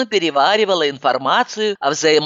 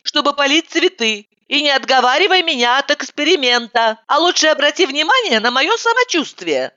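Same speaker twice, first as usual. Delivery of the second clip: high, insistent explaining; high girlish voice; 10 semitones higher than usual. Acted. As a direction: press the point in her high voice. Heroine argues, high voice.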